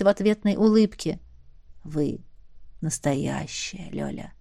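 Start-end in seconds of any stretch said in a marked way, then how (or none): 1.8-4.3 tender. A narrator's reading. Dragon speaks tenderly to Lyolya.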